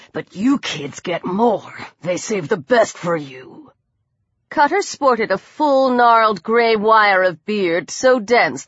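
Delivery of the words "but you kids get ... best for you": menacing low man's voice; resentful attacker. A narrator's reading. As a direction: say it deep and deliberate, resentful. Attacker's grievance to victim.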